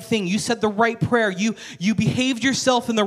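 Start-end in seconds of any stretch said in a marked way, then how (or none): none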